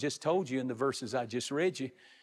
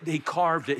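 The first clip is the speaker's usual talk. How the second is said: ordinary delivery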